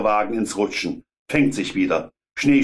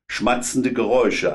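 ordinary delivery

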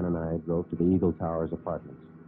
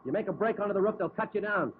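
raised, high-pitched call to the gunman; tense call; 13 semitones higher than usual. Acted. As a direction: keep him pinned with your voice. Talking down armed suspect on roof.

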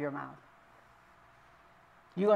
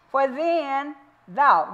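put-on drawn-out verse with the old word thou; slow old English reading; 6 semitones higher, 3 dB louder, much slower than usual. Shown as a demonstration. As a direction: stretch "For then thou" slowly, leaning on "thou". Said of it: "that's an old English word for you".

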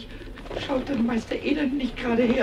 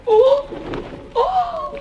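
delighted oh; 13 semitones higher, 5 dB louder than usual. Acted. Doves land near him.

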